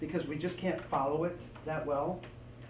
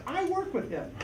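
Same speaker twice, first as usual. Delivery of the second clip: mock listener voice; 11 semitones higher than usual. Imagined listener replying.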